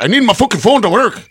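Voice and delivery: high, agitated mock Irish; bad Irish accent. Mark upset about his stolen phone, bad Irish accent. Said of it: "He's Irish, and I'm not doing a very good accent".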